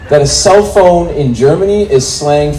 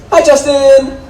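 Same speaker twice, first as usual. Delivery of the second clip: mock ex-girlfriend voice; 11 semitones higher, slower than usual. Ex voiced in story.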